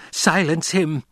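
shrill high command; screaming order. A narrator's reading. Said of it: "the old woman screamed".

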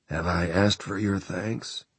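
dry, dusty, little-used voice. Acted. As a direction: croak it out like an unused throat. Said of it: "voice that sounded as dusty and little used".

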